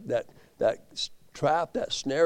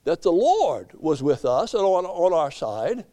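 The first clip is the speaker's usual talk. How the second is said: high, emphatic pulpit delivery; impassioned preaching; 7 semitones higher, 4 dB louder than usual. Acted. Preacher warning that the devil changes scripture, impassioned. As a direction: hold up the book and cry it out high.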